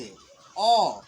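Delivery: अ said on its own, long and clear; teacher models the vowel अ for the class; model sound अ. Shown as a demonstration. Teacher modelling the vowel sound for children, drawn out. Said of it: "देखिए ध्यान से".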